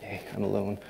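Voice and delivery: ordinary delivery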